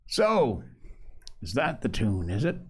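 ordinary delivery, nothing marked

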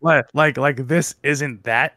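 ordinary talk

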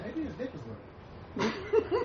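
giggling aside. Real, not acted. Joking aside about a boxer.

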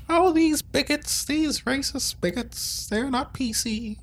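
high, drawn-out mock complaining voice; mock complainer. Podcaster mimics critics calling the hosts bigots, mock complaining.

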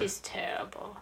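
hushed, nearly voiceless verdict; low-key disdain. Real, not acted. Giving the co-host a verdict on an actress, hushed disdain. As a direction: say it softly, under the breath.

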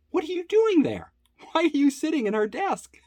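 very high-pitched imitation of affronted colleagues; incredulous challenge. Fellow readers to the man behind the desk, incredulous.

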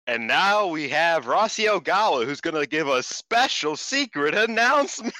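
high, loud mock announcer voice; ring announcer. Ring announcer introducing a secret announcement, hammed-up proclaiming. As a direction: imitate a ring announcer with big swooping emphasis. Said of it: "when the ring announcer said".

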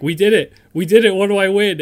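loud, high gleeful cheer; excited cheering. Retold or imitated. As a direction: cheer like you just won. Scholars cheering before being killed.

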